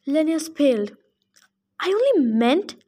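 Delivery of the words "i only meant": higher, flustered backpedal; flustered satyr. Acted. Satyr caught out by Percy, flustered and higher. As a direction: backpedal nervously.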